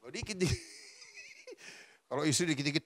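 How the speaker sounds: ordinary talk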